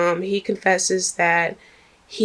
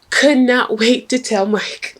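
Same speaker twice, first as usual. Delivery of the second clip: higher, slower, laughing through it; laughing emphasis; 6 semitones higher, 4 dB louder than usual. Real, not acted. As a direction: relish the gossip with a laugh.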